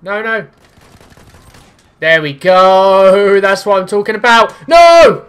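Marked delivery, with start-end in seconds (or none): 2.0-5.2 excited shout. Real, not acted. Kill then death.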